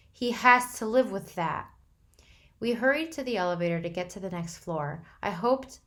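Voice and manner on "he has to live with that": near tears. A narrator's reading. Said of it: "closer to tears than I'd ever seen".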